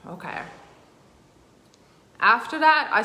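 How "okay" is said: a weary breathy sigh, then a near-silent okay. Real, not acted. She sighs to herself before continuing the story, drained. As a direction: let it all out in a long sigh, barely voicing okay.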